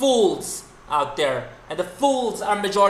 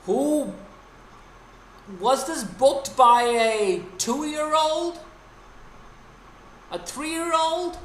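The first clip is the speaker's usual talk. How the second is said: high, slow, incredulous sneer; stretched-out scoffing question; mocking disbelief; 9 semitones higher, much slower than usual. Real, not acted. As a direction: drag it out like it's absurd. Mocking how bad the booking is.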